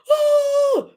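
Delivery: shrieking, high-pitched scream laugh. Shown as a demonstration. Showing viewers how she laughs with a high shriek. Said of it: "That's what I laugh like".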